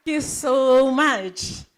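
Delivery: big, slow, booming thank-you; excited thanks. Real, not acted. MC taking the mic after the host, loud and slow. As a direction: greet the crowd with a loud, drawn-out thanks.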